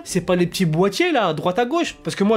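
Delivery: ordinary talk, nothing marked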